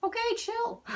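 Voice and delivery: high, incredulous "okay, chill"; mock-exasperated at the fast runner; squeaky, teasing reaction; telling them to chill. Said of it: "And I'm like".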